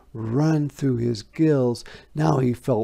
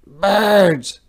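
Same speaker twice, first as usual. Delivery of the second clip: mysterious; 9 semitones higher, 10 dB louder than usual. A narrator's reading. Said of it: "said the frog mysteriously".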